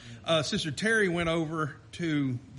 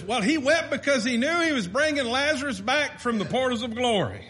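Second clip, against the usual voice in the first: mock preacher quote; 5 semitones higher, 5 dB louder than usual. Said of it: "Or another one is".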